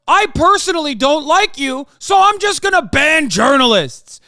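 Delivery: hammed-up shouted mockery; mock quote. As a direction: yell it as a petty tyrant. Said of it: "weren't just going".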